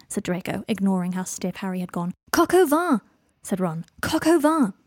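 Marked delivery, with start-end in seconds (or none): 2.3-3.0 high, loud, insistent "Coq au vin"; indignant correction. A narrator's reading. Ron corrects Draco's innuendo indignantly. 4.0-4.7 high, loud, insistent "Coq au vin"; indignant correction. A narrator's reading. Ron repeats the indignant correction.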